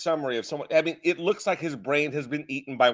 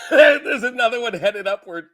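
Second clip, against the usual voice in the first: louder, giggling through the words; laughing; 4 semitones higher, 5 dB louder than usual. Real, not acted. Co-host riffing on worms in the candidate's throat, laughing. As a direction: crack up while adding to the joke.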